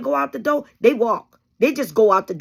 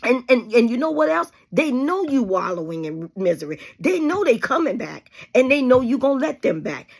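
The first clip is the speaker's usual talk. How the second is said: ordinary talk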